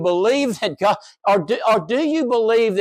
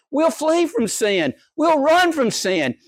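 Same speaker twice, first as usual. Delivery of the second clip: mock solemn vow; 10 semitones higher than usual. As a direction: parrot their promise back, hammed-up. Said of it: "You said".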